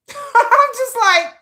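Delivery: high, loud words through laughter; laughing. Real, not acted. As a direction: crack up while talking. Cracking up at her own story.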